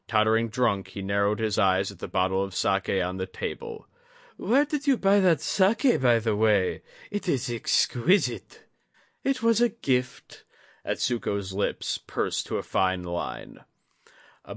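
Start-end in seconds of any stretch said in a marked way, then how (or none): 4.4-8.6 put-on high, louder drunk lord; tipsy lord. A narrator's reading. Drunk Yasuhide asks Atsuko about the sake, high. 9.2-10.4 put-on high, lips-pursed old lady; curt old lady. A narrator's reading. Atsuko answers Yasuhide about the sake, high and curt.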